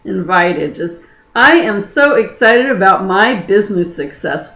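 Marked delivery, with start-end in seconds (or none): none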